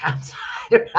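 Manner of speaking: apology squeezed through laughter; real giggling fit, barely getting words out; laughing. Real, not acted. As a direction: break up laughing as you apologize. Breaks up at her own joke.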